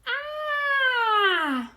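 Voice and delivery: high, loud, drawn-out "ah"; loud gasp after a fiery gulp; long gasp. A narrator's reading. Smallest fox after swallowing cider, long loud gasp. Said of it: "gasped the smallest fox".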